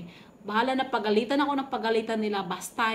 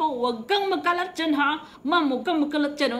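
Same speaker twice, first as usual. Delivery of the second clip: higher, louder stern warning; scolding; 5 semitones higher, 4 dB louder than usual. Retold or imitated. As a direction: warn her sharply not to make a mess. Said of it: "sinabihan pa".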